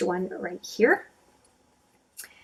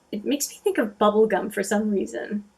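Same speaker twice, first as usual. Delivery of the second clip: ordinary delivery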